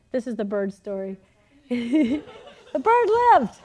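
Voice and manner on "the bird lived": high, loud triumphant announcement; mock exultant cry. Telling the congregation the bird story's punchline, exultant. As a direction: proclaim it like a miracle, laughing.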